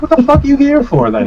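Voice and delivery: very high incredulous squawk; exasperated, high-voiced challenge; retold indignant outburst. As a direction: replay it as high-pitched outrage. Retold angry reply to vendor.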